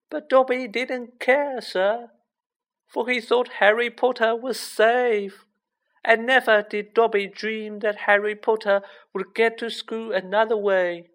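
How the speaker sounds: high-pitched elf voice, pleading; earnest house elf. A narrator's reading. House elf justifying himself to Harry, earnest high voice. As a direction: explain it high and insistent.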